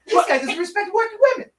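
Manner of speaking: high, fast outraged squeak; indignant rat baby. Acted. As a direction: accuse him as a rat baby. Rat baby accusing the man.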